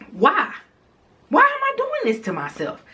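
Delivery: high exasperated disbelief. Real, not acted. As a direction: ask it like you can't believe yourself. Exasperated at arguing with the woman.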